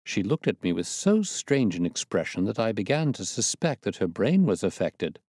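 usual speaking voice

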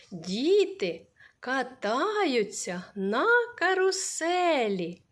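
very slow, каруселі stressed; slow example sentence. Shown as a demonstration. Teacher to children, model sentence said very slowly. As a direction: draw out each word for the class. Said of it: "Скільки слів ми тут сказали".

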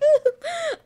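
a sudden high laugh; real giggle, high and loud; high burst of laughter. Real, not acted. Laughing at own slip.

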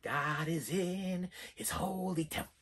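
a touch of the spooky preacher; hint of creepy old man. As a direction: murmur it like a half-heard hymn. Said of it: "He's like, walk up there".

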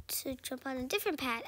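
ordinary talk

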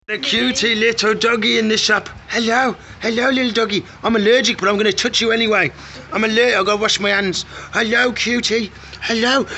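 high, loud cooing at the puppy. Real, not acted. Owner cooing at a sausage dog in the shop, high baby talk.

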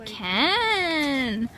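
high cackling witch voice, drawn out. Acted. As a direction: call the names like a witch. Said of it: "You sound like a witch".

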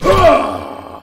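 pained shout. Acted. Sparring, taking a hit.

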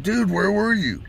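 bewildered, demanding an answer; surprised friend questioning; asking where he was. Retold or imitated. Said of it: "And I said".

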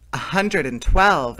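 big announced count; exclaiming. A narrator's reading. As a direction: call out the number with dramatic emphasis. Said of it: "exclaimed Martha".

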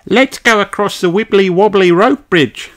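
put-on high, bright young kangaroo girl; cheerful invitation in a high child voice; Kylie voice. Kylie calls Peppa to the bridge, high eager child.

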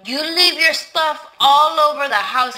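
loud, indignant telling-off; angry outburst. Acted. Housekeeper tells off employer.

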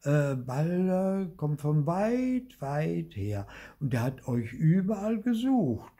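put-on a boy spinning his tale, slowly; little boy voice. A narrator's reading.

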